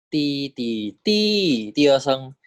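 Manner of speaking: teacher drilling the rising tone; second tone demo. Shown as a demonstration. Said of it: "第二标 gives you 第二声".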